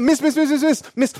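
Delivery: high, rapid-fire calling after a customer; high-pitched pestering repetition; urgent calling. Retold or imitated. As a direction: imitate a vendor desperately chasing a sale. Vendor runs after the aunt calling.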